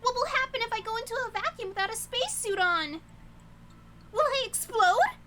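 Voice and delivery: stammering, high-pitched loud freak-out; hammed-up panic. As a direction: panic theatrically, voice shooting up. Character panics about exploding.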